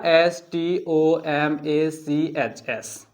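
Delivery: drawn-out classroom spelling; slow spelling. Shown as a demonstration. Teacher writing stomach on board, spelling slowly. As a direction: spell stomach out one letter at a time, very slowly. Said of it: "S add करिएगा".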